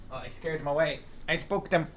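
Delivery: usual speaking voice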